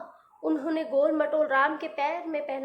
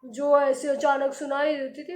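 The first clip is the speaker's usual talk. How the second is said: plain reading voice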